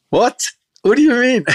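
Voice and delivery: high, incredulous questioning; retold disbelief. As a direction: recreate being thrown by the news, voice shooting up. Retold shock at Queensland roe revelation.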